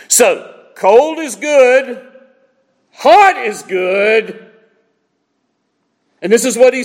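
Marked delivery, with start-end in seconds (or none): 0.1-4.5 slow emphasis. Real, not acted. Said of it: "emphatic sermon point".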